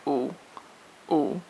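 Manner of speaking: "ooh" short and deep in the voice; short third tone. Shown as a demonstration. Teacher showing the third tone, short deep "ooh". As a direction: drop "ooh" low and keep it brief. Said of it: "in the deep part of your voice".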